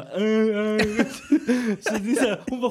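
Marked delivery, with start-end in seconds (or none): 0.0-0.8 higher mock inarticulate vocalizing; imitated wordless grunts. Comic imitating a deaf date, wordless grunts. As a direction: grunt out sounds instead of words. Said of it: "hon bara".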